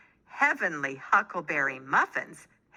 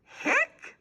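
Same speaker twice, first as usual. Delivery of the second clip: very high squeaky hiccup; big hiccup. A narrator's reading. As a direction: let the biggest hiccup burst out. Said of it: "hiccuped harder than ever".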